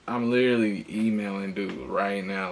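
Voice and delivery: ordinary talk, nothing marked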